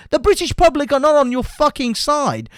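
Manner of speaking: furious, hammering the point; angry outburst. Real, not acted. Angry rant at opponents.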